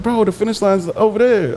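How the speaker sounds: high imitation of a teammate; mock teammate. Retelling teammates telling him he ran wrong. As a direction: play a teammate pointing out his mistake.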